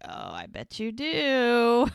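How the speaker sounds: slow, drawn-out swelling tease; teasing flirtation. Retold or imitated. He answers her pancake offer, flirty and teasing. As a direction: stretch it out suggestively, rising at the end.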